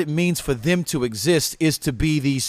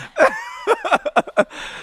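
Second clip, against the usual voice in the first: high-pitched laugh. Real, not acted. Big laugh.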